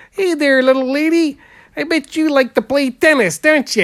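high-pitched caricaturist's pitch; imitated carnival patter. Caricaturist hails a little lady at the carnival, high patter voice. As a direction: pitch it up high, hailing a passer-by.

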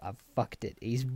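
mock defeated mutter. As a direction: grumble that he's been beaten. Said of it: "just being like".

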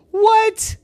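high squeak of shock on what; jolted out of the game; shocked gasp of a question; startled. Acted. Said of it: "And then Hope said".

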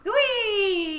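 gleeful squeal. Real, not acted. Excited squeal.

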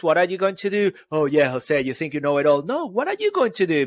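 ordinary talk, nothing marked

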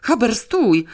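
high, urgent yell to halt the dog; screamed command. A narrator's reading. Girl calls the dog off the intruder, yelling. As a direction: cry out in alarm to stop the dog. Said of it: "wrzasnęła okropnie Janeczka".

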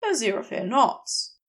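plain reading voice

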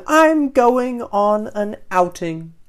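high, loud and drawn out; thrilled fish announcing an outing; excited cry. A narrator's reading. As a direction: cry it out slowly with delight. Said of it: "cries Doreen".

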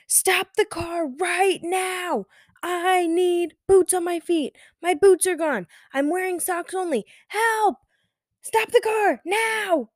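put-on high, frantic child's shriek, drawn out; panicked toddler screaming. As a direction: play a screaming three-year-old in a panic. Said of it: "this is what she was saying to me".

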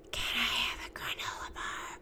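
put-on whispered request from a kid; child's whisper. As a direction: whisper it like a sneaky little boy. Son asks again, whispering.

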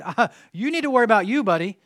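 loud, fast warning to a bully; firm warning. Retold or imitated. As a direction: stand up to him, quick and loud. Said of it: "And I said".

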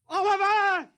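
high, loud shout of the name; calling out loud. Acted. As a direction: yell to find a lost friend. Searching, calling for Oliver.

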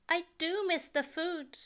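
a fussy angel missing good food; Aziraphale voice. A narrator's reading. Aziraphale's voice, wistful.

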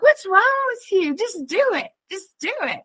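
high, exasperated push; impatient urging. Retold or imitated. Wife urging husband in the room, high impatient. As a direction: egg him on impatiently. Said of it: "I'm like".

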